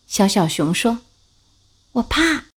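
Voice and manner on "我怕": little bear voice. Acted.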